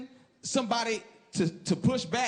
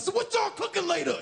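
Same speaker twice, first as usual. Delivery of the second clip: much higher, shouted small talk; mad as hell; 11 semitones higher than usual. Retold or imitated. Comic shows himself asking parents about dinner, still shouting. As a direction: ask about dinner like you're furious. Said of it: "I sound mad as hell".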